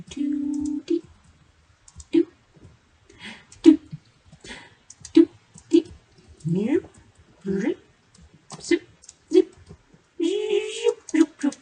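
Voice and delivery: high, loud zip zoop noises; playful sound effects. Pretend. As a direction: make silly cartoon sounds while building. Playful sound effects placing blocks.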